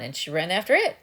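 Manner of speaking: ordinary delivery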